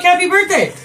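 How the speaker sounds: mock-cheery birthday wish to the cashier; chirpy happy birthday. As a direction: overdo the cheer. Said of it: "And I'm like".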